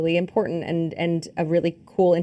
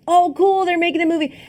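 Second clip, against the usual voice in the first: excited reaction; 11 semitones higher, 3 dB louder than usual. Retold or imitated. Voicing own reaction to movie news.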